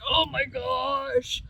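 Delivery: loud, slow exasperated groan of words; mock frustration. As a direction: act out being fed up while rubbing your face. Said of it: "rubbed my face like this, like".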